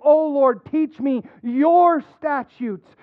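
prayerful, leaning into each word; fervent emphasis. Shown as a demonstration. Pastor reading psalm aloud, lifting O Lord fervently. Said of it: "I'm gonna emphasize it as I read".

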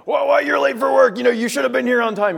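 high, loud indignant boss; scolding boss. Acted. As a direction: burst out at a late employee. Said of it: "your boss is like".

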